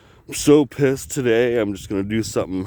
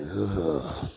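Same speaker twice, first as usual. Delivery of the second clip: ordinary talk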